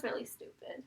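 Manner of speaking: soft whispered verdict. Real, not acted. Teen judging the mean post, whispered. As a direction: hush it as a judgment.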